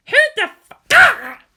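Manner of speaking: choked off mid-curse as she is slammed; cry of pain. A narrator's reading. Asuka slammed into the bench, breaking into a pained cry. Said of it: "heaved out in pain".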